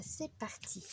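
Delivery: soft unvoiced whisper to launch the draw. Real, not acted. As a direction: breathe "C'est parti" in a hushed whisper.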